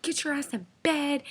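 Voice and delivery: high, exasperated scolding; retold mad order. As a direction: act out fed-up girlfriend ordering him to bed. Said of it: "I was, like, mad at this point".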